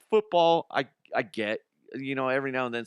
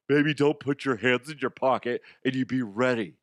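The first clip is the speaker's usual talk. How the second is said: slightly raised, mocking imitation of a know-it-all; mock critic quote; 3 semitones higher, 5 dB louder than usual. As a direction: lightly mimic an online critic's scolding. Mock quote of social media critics.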